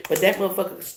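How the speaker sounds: ordinary talk, nothing marked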